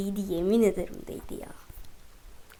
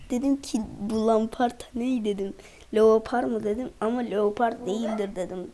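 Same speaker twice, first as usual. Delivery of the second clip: ordinary talk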